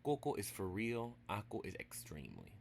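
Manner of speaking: ordinary delivery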